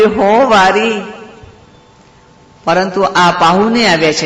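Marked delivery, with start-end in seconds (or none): 0.0-0.9 high eager answer; devoted reply. A narrator's reading. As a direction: answer the call lovingly, pitched high. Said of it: "નરો પણ બોલી".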